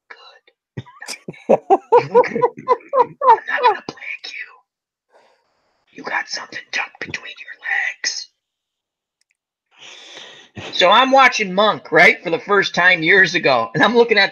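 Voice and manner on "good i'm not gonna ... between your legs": unvoiced creepy whisper, drawn out; creepy killer whisper. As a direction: hauche es wie ein unheimlicher Killer.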